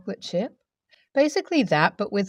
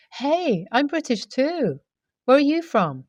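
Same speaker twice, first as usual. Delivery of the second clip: higher-pitched, eager hello; excited greeting; 6 semitones higher than usual. Acted. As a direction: greet a fellow Brit with excitement. Excited greeting to fellow Brit.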